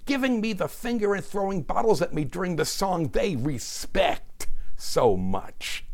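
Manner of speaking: high-pitched quoted rant; aggrieved rant in someone else's words; Roseanne Barr. Acted. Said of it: "Miz Barr fondly recalled".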